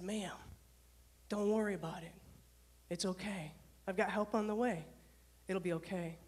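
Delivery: low, soft, measured reassurance with pauses; calm her down gently, sentence by sentence; reassuring. Retold or imitated. Dispatcher to crying caller with help coming, reassuring.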